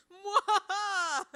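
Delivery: slow, drawn-out mock villain cackle; evil villain laugh. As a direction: cackle like a mustache-twirling villain. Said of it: "twirl your imaginary mustache".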